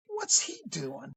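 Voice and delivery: high, slow puzzled question; mildly baffled. Retold or imitated. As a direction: stretch it out in disbelief. Baffled at kid approaching.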